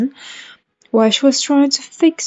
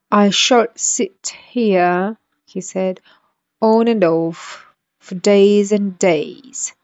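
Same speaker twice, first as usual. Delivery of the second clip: slow and drawn out; slower than usual. Acted. Footman's slow remark.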